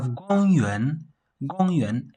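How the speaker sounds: "gongyuan" with high "gong", then "yuan" dipping and rising. Shown as a demonstration. Teacher showing two tones, "gongyuan" high then rising. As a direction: hold "gong" high, lift "yuan" from below. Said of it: "this is a high pitch".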